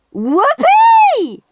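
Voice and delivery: very high, loud whoop; joyful scream. A narrator's reading. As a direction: cheer the win. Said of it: "screamed Henry".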